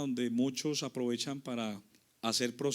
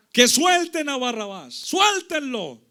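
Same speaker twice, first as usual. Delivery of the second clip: high, loud acted crowd cry; drawn-out imitation of people yelling; crowd's shout; 9 semitones higher, 11 dB louder, slower than usual. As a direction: shout it like a mob demanding a release. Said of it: "todo el pueblo empezó a gritar".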